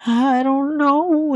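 very high, slow, drawn-out squeak; squeaky clueless reply. Acted. Answering the galaxy beast's question, squeaky and clueless. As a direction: stretch it up high like a scared little voice.

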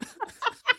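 high, soft helpless laugh; tearful laughter. Real, not acted. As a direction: laugh weakly through tears. Speaker crying with laughter.

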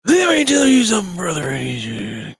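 high, loud gravel-free wrestler yell; hammed-up Macho Man promo shout; Macho Man impression. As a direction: ham up a pro wrestler promo. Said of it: "That's Macho Man".

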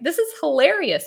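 high giggly retelling through laughter; laughing. Retold or imitated. To herself during the ceremony, laughing. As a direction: laugh it out, voice jumping up. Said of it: "I started laughing 'cause I was just like".

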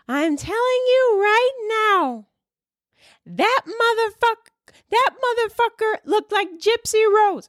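put-on very high-pitched girlish squeal of insistence; squeaky, drawn-out put-on little voice. Comic insisting on an audience member's lookalike, high squeaky mimicry.

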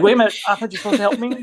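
high, rushed mock disbelief; mock protest. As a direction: protest fast and high, playing up the absurdity. Retold comic protest to counselor.

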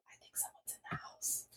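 terrified whisper to the dispatcher; voiceless hush, afraid to be heard; whispering on the phone. Retold or imitated. New mom whispering to 911 about an intruder, hushed and scared. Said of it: "I'm, like, whispering on the phone".

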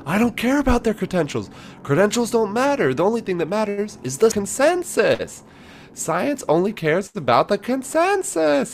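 very high-pitched hysterical imitation; mock hysterical. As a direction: mimic a hysterical debater in a squealing head voice. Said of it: "like hysterical, going".

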